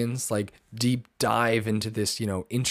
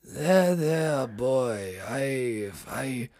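mock English accent, high opening then sinking; put-on English doctor voice; Doctor James's English accent; 3 semitones higher, much slower than usual. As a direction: imitate a channeled Englishman, theatrically. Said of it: "can you even do the accent that I showed you?".

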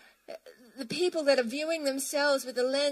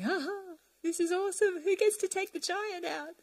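put-on eager young David; 6 semitones higher than usual. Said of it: "David was coming down fresh-eyed and saying".

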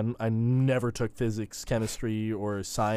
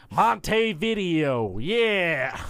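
hammed-up American accent, high and slow; proudly overdone American accent; 7 semitones higher, 4 dB louder, much slower than usual. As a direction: draw out Montevideo in a broad American accent. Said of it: "That's how we say it here in America".

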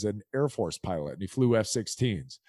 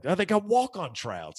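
put-on higher-pitched Chicago-accented pitch; 8 semitones higher than usual. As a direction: imitate a friend's Chicago accent. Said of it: "He's from Chicago. He's got this accent".

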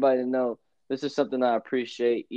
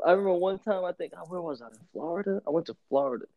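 normal talking voice

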